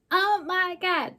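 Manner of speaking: high, loud, drawn-out exclamation; mock outcry. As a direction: wail it in exaggerated shock. Voices Joel's exclamation in recap.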